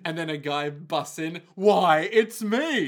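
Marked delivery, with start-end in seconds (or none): 1.5-2.9 loud, higher grand entrance; hammy triumphant villain entrance; theatrical reveal. Acted. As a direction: burst in and announce yourself. Guy bursting in with reveal.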